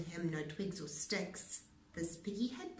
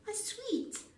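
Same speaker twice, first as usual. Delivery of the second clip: put-on sweet girl; 13 semitones higher than usual. A narrator's reading. Red to Pig.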